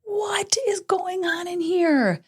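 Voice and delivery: high, slow, hissed exasperation; sharp exasperated call to her daughter; outburst. Retold or imitated. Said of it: "I had an outburst".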